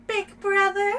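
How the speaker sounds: high, loud little-sister call; big-brother catchphrase voice. Retold or imitated. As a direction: pitch it high like the character calling out. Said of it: "gonna do that in that voice every time".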